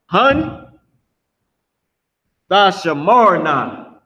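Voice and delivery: "Hun, Thashamarna" slow, with a pause after Hun; pronunciation demo. Shown as a demonstration. Teacher showing students how to say they will watch, "Hun, Thashamarna" slowly. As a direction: model "Hun, Thashamarna" word by word. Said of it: "That's how you say".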